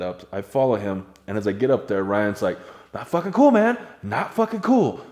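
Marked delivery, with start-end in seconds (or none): 2.9-5.0 higher, louder indignant scolding; angry scolding. Retold or imitated. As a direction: scold them for scaring you. Ryan angry at the prank.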